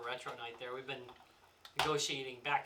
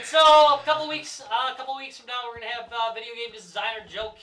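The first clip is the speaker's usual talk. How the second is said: announcer voice; 6 semitones higher, 12 dB louder than usual. Acted. Host switches to sign-off.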